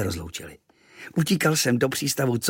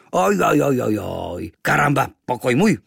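disapproving native; 5 dB louder than usual. A narrator's reading. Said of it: "Domorodec nesouhlasně vrtěl hlavou".

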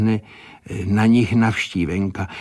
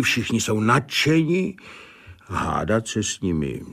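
plain reading voice

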